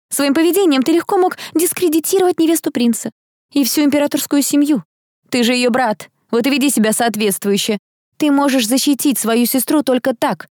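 put-on high-pitched, indignant young woman's telling-off; scolding girl. Girl blames Tom for endangering his sister, scolding. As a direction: scold him in a sustained high voice.

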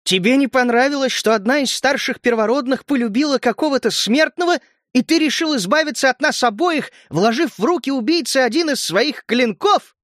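high-pitched, hurt accusation; accusing cry. A narrator's reading. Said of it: "крикнула ему в спину Галия".

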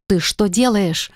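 usual speaking voice

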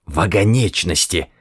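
light hissed correction. A narrator's reading. Ford correcting Arthur under his breath, lightly hissing. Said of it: "прошипел Форд".

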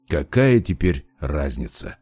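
slow, low, understated snap; a touch of irritation, kept low and slow; snapping back. A narrator's reading. Father dismissing his friend's reasoning, understated low snap. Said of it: "огрызнулся Велесов".

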